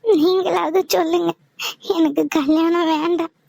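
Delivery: pleading through tears; a daughter in tears refusing the marriage; tearful pleading. Acted. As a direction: beg them to stop the wedding while crying. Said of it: "ஏன் அழற?".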